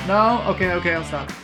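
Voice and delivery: usual speaking voice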